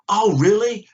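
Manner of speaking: high, loud mock surprise; surprised. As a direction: feign shock, loudly. Mock chat with a grading instructor.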